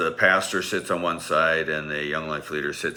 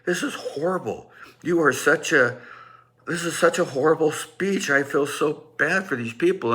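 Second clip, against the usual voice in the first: inner panic; 10 semitones higher than usual. Retold or imitated. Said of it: "I'm like".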